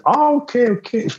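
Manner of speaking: high-pitched, sarcastic giving in; mock acceptance. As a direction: act out being oh so reassured. Mock acceptance of her Texan boast.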